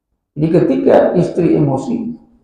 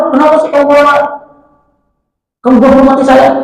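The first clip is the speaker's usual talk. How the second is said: indignant husband; 9 semitones higher, 3 dB louder than usual. Retold or imitated. Husband snapping at angry wife.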